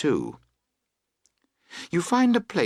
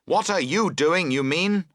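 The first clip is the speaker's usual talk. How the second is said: higher, louder snappy comeback; angry retort; 8 semitones higher, 5 dB louder than usual. Acted. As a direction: throw the blame right back at him. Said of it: "he answers angrily".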